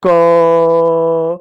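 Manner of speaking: क held long so the अ sound rings at the end; sustained letter sound; drawn-out demo. Shown as a demonstration. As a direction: stretch क out to show its अ ending. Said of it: "अगर आप मेरे साथ बोलो".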